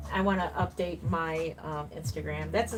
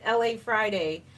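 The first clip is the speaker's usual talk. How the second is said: normal talking voice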